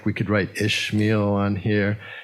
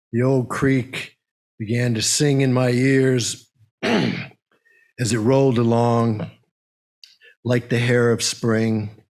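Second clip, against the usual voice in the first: poem reading. Acted. Reads a nature poem aloud.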